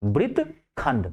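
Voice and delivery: वृत्तखंड drawn out syllable by syllable; slow dictation. Shown as a demonstration. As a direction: spell वृत्तखंड out slowly for the class to write down. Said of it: "क्या बोलते हैं?".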